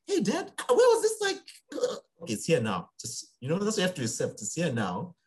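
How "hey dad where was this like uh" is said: much higher, a bit louder protest; playfully cheated outcry; mock indignant. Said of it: "I felt gypped".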